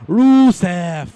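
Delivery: huge drawn-out announcer shout; ring announcer call. Pretend. Mock commentator introducing Rusev to the crowd, booming. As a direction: bellow the name to the arena.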